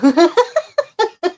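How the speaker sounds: high, loud burst of laughter; real cackling laugh; loud giggling laugh. Real, not acted. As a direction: crack up laughing at your own joke. Own laugh at fluff joke.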